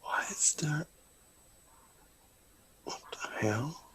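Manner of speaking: hushed whisper. Real, not acted. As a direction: whisper it aside. Aside under the table talk.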